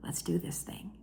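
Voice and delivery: whisper. Real, not acted. Hushed start before mysterious clip.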